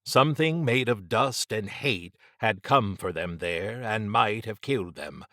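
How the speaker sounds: ordinary delivery, nothing marked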